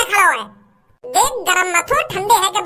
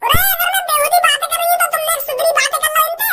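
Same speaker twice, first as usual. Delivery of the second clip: put-on higher, sharp young woman arguing with the officials; defiant daughter voice; 5 semitones higher than usual. Daughter scolding the gas godown men, defiant higher voice. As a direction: play the fed-up daughter, shrill and cheeky.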